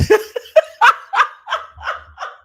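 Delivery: loud, high, long cackle; real laughter. Real, not acted. Host reacting to viewer's comment, long loud laughter.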